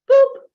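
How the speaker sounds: loud, high squeaky "Boop"; playful boop. Shown as a demonstration. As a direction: pop the "Boop" way up in pitch and volume. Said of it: "let's see the shift".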